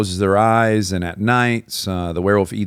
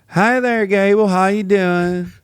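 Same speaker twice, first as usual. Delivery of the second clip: high, slow Southern greeting; Southern drawl; 11 semitones higher, slower than usual. Retold or imitated. Richard from the South calling an old woman, Southern drawl. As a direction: imitate a drawling Southern phone caller. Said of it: "He's from the South".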